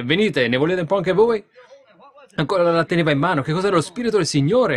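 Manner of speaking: high, loud fervent preaching; shouted preacher's climax; preaching at full force. Acted. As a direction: let it ring out like a revival sermon.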